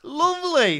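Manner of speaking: high, bright "lovely"; delighted. Real, not acted. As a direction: gush with delight. Exaggerated delighted exclamation.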